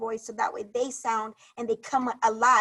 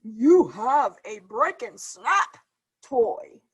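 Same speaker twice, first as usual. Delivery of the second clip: put-on slow, lightly lowered girl's voice; a hint of a deeper-voiced new friend; deeper character voice; 5 dB louder, slower than usual. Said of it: "Girl sounds pretty deep voice".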